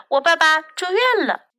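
lightly voiced little boy; child's voice. A narrator's reading. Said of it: "杰克说".

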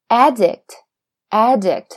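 "addict" stressed on AD, slow and loud; stress on first syllable. Shown as a demonstration. Teacher shows the noun's shifted stress, "addict" as AD-dict, front-stressed. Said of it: "现在重音移到了前边儿".

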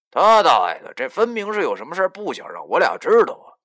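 loud, high, exasperated outburst; riled-up, suspicious grumble; indignant inner cursing. A narrator's reading. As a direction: curse them in your head at full voice. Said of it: "我心中暗骂道".

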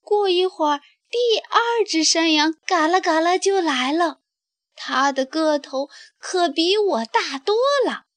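high pleading little goat. Acted. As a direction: beg like the smallest goat. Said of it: "小山羊回答".

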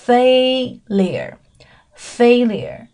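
failure said slowly with a long FAY first syllable; common pronunciation. Shown as a demonstration. Teacher showing learners the common reading of failure, slow careful demo. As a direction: stretch failure into a clear FAY-lyer. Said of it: "是比较常见的，叫做".

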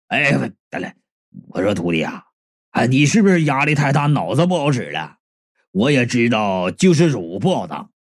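low-pitched uncle voice; kindly meddling martial uncle, coaxing. Acted. Uncle offers to counsel his stressed disciple, low and coaxing.

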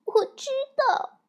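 aggrieved. Acted. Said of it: "小熊妹妹委屈地说".

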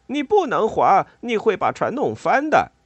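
high, loud urgent warning; alarmed shout. A narrator's reading. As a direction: cry out in panic that disaster is coming. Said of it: "莱特在座位底下喊道".

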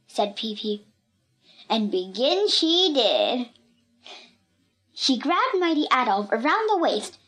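plain reading voice

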